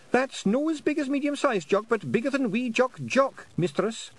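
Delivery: high squeaky Scottish pixie correcting; tiny Scots warrior, chirpy and high; Feegle voice. Acted.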